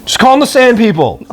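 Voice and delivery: teasing. Real, not acted. Jokey suggestion, big pitch jump.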